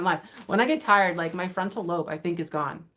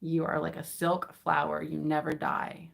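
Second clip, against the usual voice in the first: normal talking voice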